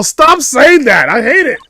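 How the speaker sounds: high, loud protest with drawn-out words; exasperated outburst. Acted. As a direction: cry out in exasperation. Acted protest at game line.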